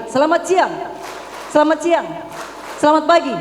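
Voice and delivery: rally the crowd with the greeting; loud high call. Shown as a demonstration. Speaker to parents, clap cue called out loudly. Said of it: "selamat siang dua kali".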